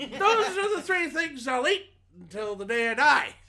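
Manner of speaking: hammed-up celebrity impression; Bill Cosby. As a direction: pitch it way up and stretch the words. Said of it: "I'm still Cosbying".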